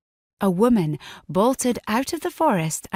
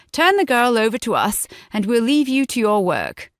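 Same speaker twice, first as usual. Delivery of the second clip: higher, louder voice of a bossy guard; guard on horseback demanding the fugitive; commanding English guard; 5 semitones higher, 5 dB louder than usual. Acted. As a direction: order him to hand her over.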